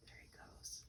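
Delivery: whispered aside to the listener. Real, not acted. Reader whispering to the child as Paddington runs off. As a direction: hush it like a secret.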